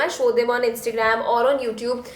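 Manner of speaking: ordinary talk, nothing marked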